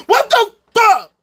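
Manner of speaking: high, loud disbelief; exasperated outburst. Real, not acted. Ranting at the two-party system, exasperated shout. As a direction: blurt it out, appalled.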